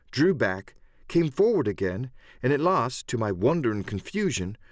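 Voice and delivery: ordinary delivery, nothing marked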